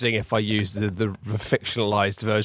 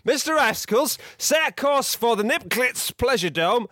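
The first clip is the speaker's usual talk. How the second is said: hammy captain; 6 semitones higher than usual. Acted. Captain character speaking.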